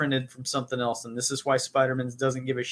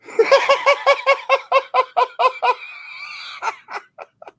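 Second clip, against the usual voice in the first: high, loud imitated Tusken Raider ululation; Tusken Raider cry; 6 dB louder than usual. Shown as a demonstration. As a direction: let out a long pulsing alien war cry. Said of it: "I've got written down Tusken Raider".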